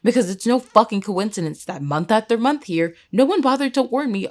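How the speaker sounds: loud, heated demand with punched key words; angry outburst. Acted. Student rails at friend about credit mix-up, angry. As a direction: vent fury at an unfair system. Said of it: "Who do I get to be angry with".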